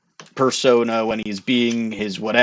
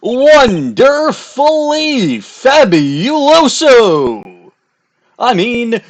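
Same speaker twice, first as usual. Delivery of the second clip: much higher, louder, slow flamboyant gush; hyped-up showman; 10 semitones higher, 6 dB louder, much slower than usual. Acted. Showman admiring himself to the visitors, slow flamboyant gush. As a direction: savour every syllable like a diva. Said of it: "that hyped up persona".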